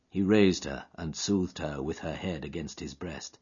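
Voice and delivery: ordinary delivery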